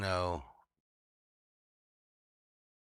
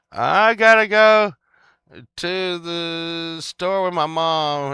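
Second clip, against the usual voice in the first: put-on high, slow, drawn-out childish whine; mock whiny kid; 10 semitones higher, 11 dB louder, slower than usual. Kid dragged to the store with mom, mock whine. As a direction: drag it out like a reluctant kid.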